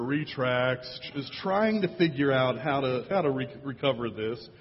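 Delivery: normal talking voice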